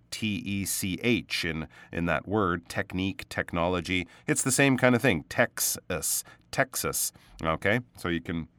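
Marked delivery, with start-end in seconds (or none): 5.3-7.1 "Texas" with a crisp aspirated T as in "tech"; correct Texas. Shown as a demonstration. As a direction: start "Texas" like "tech" in technology. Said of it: "I think that word was, uh, mispronounced".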